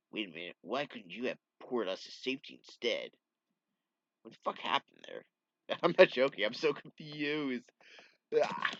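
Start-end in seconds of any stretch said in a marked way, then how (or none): none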